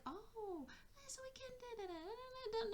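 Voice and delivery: high, soft, light babbling; happy child. Acted. Inner child cheered up and making plans, high and happy. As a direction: babble happily in a high little voice. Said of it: "Now she's happy".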